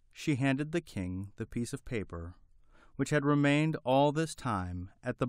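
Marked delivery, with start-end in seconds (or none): none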